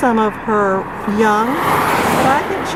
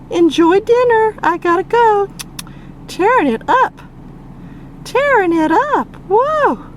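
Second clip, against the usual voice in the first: pet talk to a bird; 7 semitones higher than usual. Real, not acted. High pet talk to eagle.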